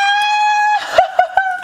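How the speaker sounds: giddy screaming laugh; shrieking laugh. Real, not acted. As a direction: burst out in a shrill giggle. Shrieking laugh while excited.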